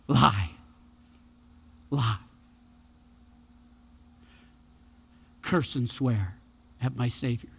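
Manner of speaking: low, weighty single words with long pauses; solemn preaching. Real, not acted. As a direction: let each word drop and hang. Solemn contrast of two trials.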